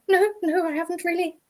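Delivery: light, high retold protest; reply in a small high voice, well above your own; high-pitched, mildly flustered quote; high-pitched denial. Retold startled denial to client.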